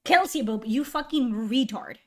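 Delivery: normal talking voice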